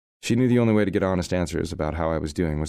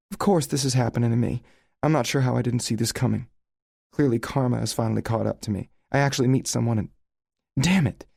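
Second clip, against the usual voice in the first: hurt, near tears; 7 semitones higher, 3 dB louder than usual. A narrator's reading. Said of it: "eyes sparkle with unshed tears".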